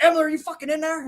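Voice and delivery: high, mock-furious call through a door; imitated angry call. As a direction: demand an answer from outside the room. Angry friend calling through the door.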